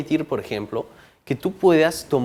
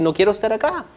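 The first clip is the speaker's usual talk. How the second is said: raised, pleading inner voice of fear; 7 semitones higher, faster than usual. Retold or imitated. Speaker voicing the fear of public speaking, mildly pleading. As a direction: let the scared inner voice beg to leave. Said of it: "te van a decir".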